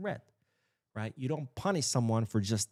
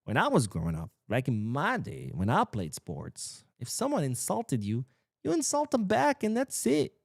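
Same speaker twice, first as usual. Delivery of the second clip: put-on old man rant; 8 semitones higher than usual. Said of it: "this is where the old man comes in".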